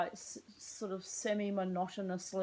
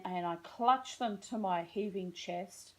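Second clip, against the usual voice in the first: ordinary talk, nothing marked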